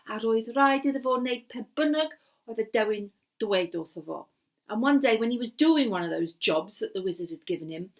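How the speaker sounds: ordinary delivery, nothing marked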